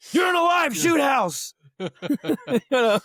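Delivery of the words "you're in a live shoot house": much higher, loud drill yell; mock sergeant yell. Platoon sergeant yelling at a trainee below, in a loud mock shout. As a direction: yell it down from the catwalk.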